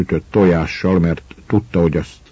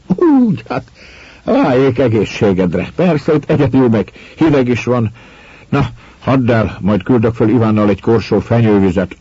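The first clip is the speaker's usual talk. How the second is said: higher, louder, merry and amused; cheerful; 5 semitones higher, 4 dB louder than usual. A narrator's reading. Husband cheerfully forgives the wife for the brandy, merrily. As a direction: laugh it off, brightly teasing the wife. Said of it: "Az úrfi vidáman fölkacagott".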